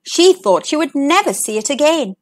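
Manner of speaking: ordinary delivery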